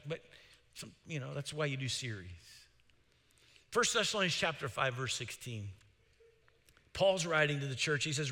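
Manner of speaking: usual speaking voice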